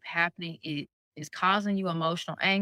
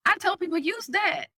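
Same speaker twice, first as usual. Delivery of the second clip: emphatic; 12 semitones higher than usual. Real, not acted. Emphatic advice, very high.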